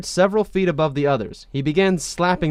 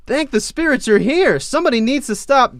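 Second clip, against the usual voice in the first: relieved plea; 11 semitones higher, 5 dB louder than usual. Acted. Relieved Aang pleading.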